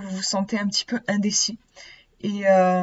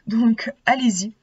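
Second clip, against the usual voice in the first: usual speaking voice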